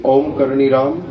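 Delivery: ओम Karni राम joined in one unbroken phrase; all three together. Shown as a demonstration. Teacher has pupils say the three words at once, joined chant. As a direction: run ओम, Karni and राम together without pauses. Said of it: "अब तीनों को एक साथ बोलिए".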